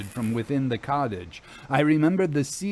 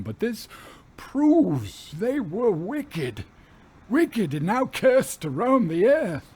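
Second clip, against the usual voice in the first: put-on raving old captain; 5 semitones higher than usual. A narrator's reading. Captain raving at the women, frantic and defiant. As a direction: rant that the wicked men have come back.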